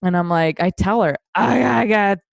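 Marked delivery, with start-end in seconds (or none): 1.3-2.2 hammed-up queasy drunk, starting louder; drunk about to puke. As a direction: imitate a sick drunk pleading. Acts drunk self needing to puke.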